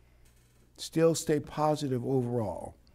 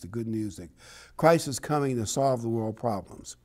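normal talking voice

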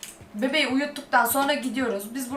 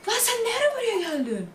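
startled shriek; 11 semitones higher than usual. Real, not acted. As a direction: jump out of your skin, voice shooting up high. Baby appears again.

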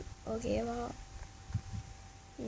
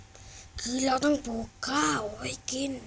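slow instruction; much slower than usual. Acted. Dad gives son a task.

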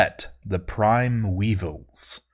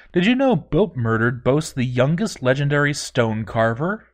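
ordinary delivery, nothing marked

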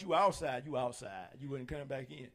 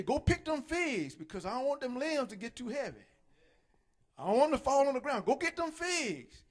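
higher, louder bossy parent; parent ordering; 6 semitones higher, 4 dB louder than usual. Retold or imitated. Parents sending kids out to pick figs, bossy.

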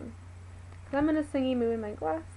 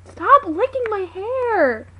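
slow, higher, louder scolding yell; drawn-out yell; 7 semitones higher, 9 dB louder, much slower than usual. Real, not acted. Owner yells slowly at the dog licking her hair.

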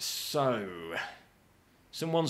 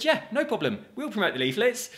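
mock eager agreement; 9 semitones higher, 3 dB louder than usual. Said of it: "so we said".

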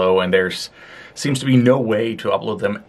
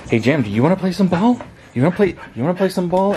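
excited pet talk; 9 semitones higher, faster than usual. Real, not acted. Excited to play ball.

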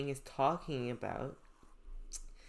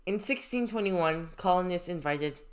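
clear, louder classroom reading of a passage; reading aloud; 4 semitones higher, 8 dB louder than usual. Acted. Teacher reading a story passage to students, clear read-aloud. Reads history text aloud.

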